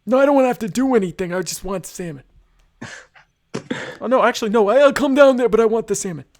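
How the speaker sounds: high, loud whiny Larry David; Larry David impression. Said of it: "Excellent Larry David impersonation".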